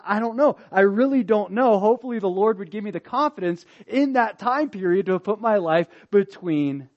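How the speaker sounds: usual speaking voice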